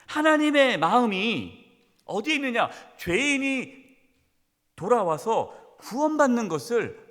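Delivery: fervent preaching. Acted. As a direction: press the Pharisees hard. Preaching at full force. (힘차게 꾸짖는 설교)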